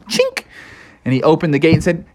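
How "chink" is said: very high short clink; chain clink. A narrator's reading. Froggy unchains the beast, high clink noise. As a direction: make a high metallic click.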